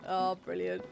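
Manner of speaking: usual speaking voice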